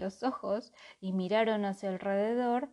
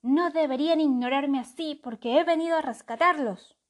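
offended crow; 6 semitones higher, 7 dB louder than usual. A narrator's reading.

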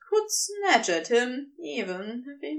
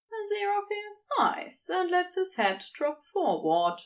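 plain reading voice

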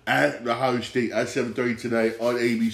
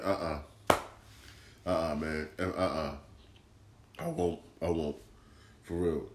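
firm refusal; 5 semitones lower, 8 dB quieter than usual. Retold or imitated. Telling people he won't recommend it now, low soft refusal. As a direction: lower and much softer, flat head-shake no. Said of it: "I'm like".

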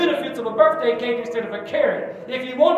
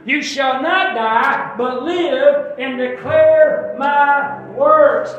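very slow, louder proclamation; slow scripture read; 3 semitones higher, 9 dB louder, much slower than usual. Shown as a demonstration. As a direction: declare each word slowly. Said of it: "Verse twenty-two".

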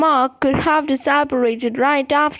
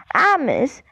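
plain reading voice

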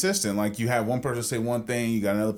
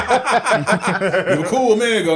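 laughing; 8 semitones higher, 8 dB louder than usual. Real, not acted. Laughing teasing line.

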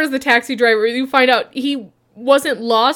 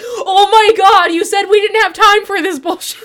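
higher, louder, laughing exasperation; exasperated boss; 8 semitones higher, 4 dB louder than usual. Retold or imitated. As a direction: pink boss guy complains about the wasted trip, high and laughing. Pink boss complains, laughing.

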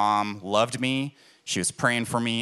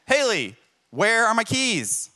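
higher, louder frustrated call for the keys; frustrated husband; 10 semitones higher, 3 dB louder, slower than usual. Retold or imitated. Husband late for work calls to his wife about his keys, exasperated and higher.

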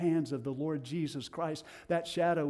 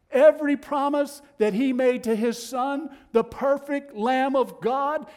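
loud, rising preacher's cry; full-force preaching; 7 semitones higher, 6 dB louder than usual. Acted. Preacher thundering to the congregation, loud and rising. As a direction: build it to a ringing peak.

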